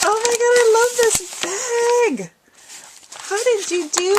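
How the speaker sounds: high, loud, squealing with delight; thrilled squeal. Real, not acted. Thrilled at handmade bag.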